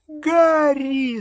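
very high-pitched squeal; panicked squeak. A narrator's reading. Hermione squeals to Harry in the atrium, panicked squeak. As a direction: squeak it out far above your own voice. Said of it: "запищала Гермиона".

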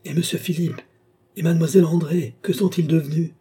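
high, urgent called questions; crying out. A narrator's reading. Nicole at the hotel door asks after the missing pair, crying out. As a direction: call out anxiously, pitched well up.

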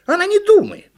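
very high, loud accusation; squeaky monkey complaint; indignant monkey. A narrator's reading. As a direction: tattle in an outraged squeal. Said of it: "возмутилась «Почешись»".

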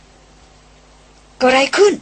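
a narrated brother nearly shouting the question. A narrator's reading. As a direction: ask it almost shouting, alarmed. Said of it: "ถามเกือบจะเป็นเสียงตะโกน".

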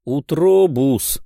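drawn-out condescending call. Acted. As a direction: address him like a fool. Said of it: "снисходительно начал Рувендил".

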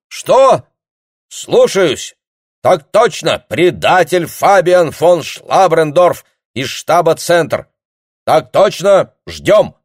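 loud, high shouting into the receiver; shouting on the phone. Acted. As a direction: bellow it down a bad line. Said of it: "обрадованно заорал капитан".

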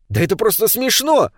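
high-pitched exclamation of protest; indignant. A narrator's reading. David disputes the gnome's denial, indignant. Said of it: "возмутился Дэвид".

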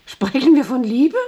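high, loud challenge; incredulous question. Acted. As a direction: question it in scornful disbelief. Challenges the word love.

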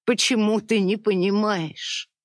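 tearful pleading. A narrator's reading. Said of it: "дрожащий голос и душившие её рыдания".

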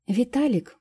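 high, loud calling out a name. A narrator's reading. Fairy calling out to Vitalik, loud high call. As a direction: call out to stop him. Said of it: "услышал громкий голос феи".

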